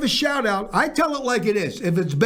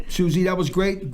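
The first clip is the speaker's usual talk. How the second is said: ordinary talk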